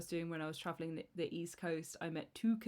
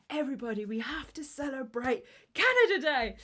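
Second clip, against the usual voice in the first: high, loud cheer building to the end; rally the whole room, mock-overjoyed; imitated excited rallying; 7 semitones higher, 8 dB louder than usual. Imitating women rallying everyone to celebrate.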